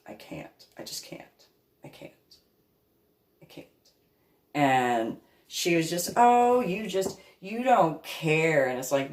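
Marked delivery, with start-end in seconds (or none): 0.1-3.7 whispered despair. Real, not acted. Overwhelmed disgust trailing off. 6.2-8.7 high, slow whiny mock voice; mocking Pam. Imitating Pam accusing her of not caring, slow high whine.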